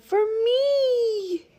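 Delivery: very high, drawn-out squeal; thrilled little rabbit voice; delighted cry. A narrator's reading. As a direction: stretch it into a happy high squeal. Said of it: "he cried".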